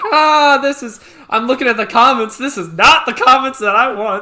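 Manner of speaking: loud, high-pitched retold dismay; mock alarm. Creator retelling his shock at unwanted comments, mock alarm. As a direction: act out a loud, appalled reaction.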